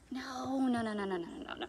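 ordinary delivery, nothing marked

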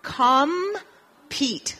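equal drawn out in clear English, falling at the end; slow English model word. Shown as a demonstration. Teacher modelling a word for students, drawn out. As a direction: model equal slowly for the class. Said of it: "otra palabra".

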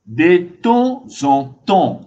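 "De temps en temps" slow and firm, each syllable separated; pronunciation model. Shown as a demonstration. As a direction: spell out "De temps en temps" by sound, one emphatic beat per syllable. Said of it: "Vous prononcez comme ça". (« De temps en temps » détaché, syllabe par syllabe)

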